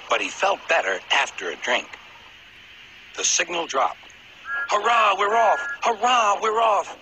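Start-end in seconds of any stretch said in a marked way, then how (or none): 4.7-7.0 high, loud, bursting hurrah; excited cheer. Acted. Thomas puffing off again, high excited cheer. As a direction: cheer as the race restarts. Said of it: "puffed Thomas".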